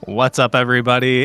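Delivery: ordinary delivery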